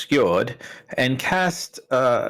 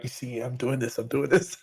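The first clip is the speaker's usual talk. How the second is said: stage whisper; 7 dB quieter than usual. Acted. Aside to party, whispered.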